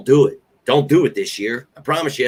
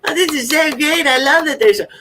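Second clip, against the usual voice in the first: sarcastic gushing, much higher pitched; mock delight; 3 dB louder than usual. Fan mocks pretending to be thrilled, sarcastic high gushing. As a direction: fake cheerful praise for the injuries. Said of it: "I'm not like".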